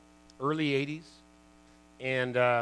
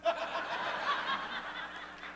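high airless giggle; real amused wheeze of laughter; high breathless laugh; 7 dB quieter than usual. Real, not acted. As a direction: laugh silently at the memory. Laughing at his own story.

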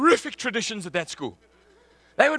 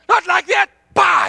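angry shout; 8 semitones higher, 10 dB louder than usual. Retold or imitated. Captain scolding him in scrum.

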